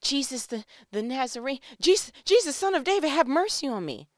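high, loud desperate cry to Jesus; desperate shout. Acted. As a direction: beg at the top of your voice. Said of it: "But he shouted".

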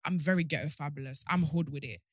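usual speaking voice

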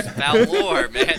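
laughing out the words, high and loud; cracking up while egging on a friend. Real, not acted. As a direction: blurt it through a big laugh.

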